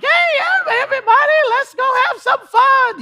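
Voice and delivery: very high, loud squeaky puppet shout; rally the kids like a cheery Elmo. Retold or imitated. Costumed dad rallying the party kids in high Elmo voice. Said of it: "I had the voice and everything".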